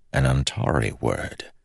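ordinary delivery

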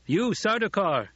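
high, slow, commanding hail; calling out. A narrator's reading. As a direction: call sternly across to the enemy. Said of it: "Paul called to the remaining group".

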